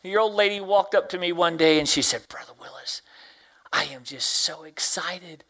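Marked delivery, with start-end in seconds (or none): 2.3-5.4 retold excited whisper. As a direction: whisper it like a secret joy. Old lady's quote retold.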